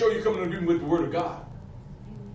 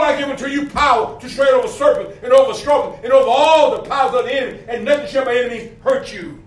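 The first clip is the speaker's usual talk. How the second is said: loud, driving sermon delivery; fiery pulpit proclamation; full-force preaching; 4 semitones higher, 6 dB louder than usual. Acted.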